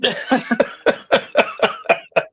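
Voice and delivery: breathy wheezing laugh; unvoiced laugh. Real, not acted. Laughing at the bad interceptions.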